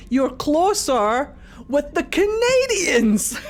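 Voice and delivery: giggling through the line; laughing. Acted. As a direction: laugh it out as you say it. Repeats own joke, laughing.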